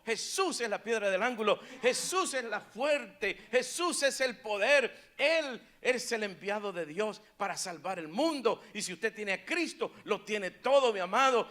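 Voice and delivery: loud, climactic pulpit proclamation; fervent preacher rousing the congregation; full-force preaching. Acted. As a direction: hammer out each declaration. Preaching climax about Jesus.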